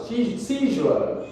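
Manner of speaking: "seizure" slow, high on SEE, falling to a sure-like zh ending; pronunciation demo. Shown as a demonstration. Teacher explains the sure sound, pronunciation demo. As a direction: model "seizure" as SEE-zhuh, drawn out. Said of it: "Because this is a sure sound".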